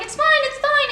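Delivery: high, loud forced reassurance. Retold or imitated. Comforting the crying counter girl, forced cheer. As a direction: brush it off, too bright.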